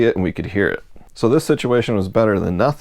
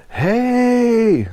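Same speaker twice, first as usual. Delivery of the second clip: yelled hey. Retold or imitated. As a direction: call out across the water. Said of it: "and Dan yelled".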